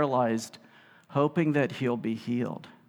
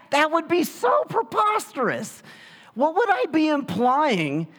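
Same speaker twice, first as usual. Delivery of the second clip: outraged exclaim; 12 semitones higher than usual. Real, not acted. Preacher exclaims at absurdity.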